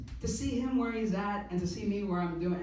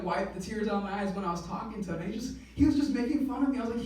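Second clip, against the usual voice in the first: normal talking voice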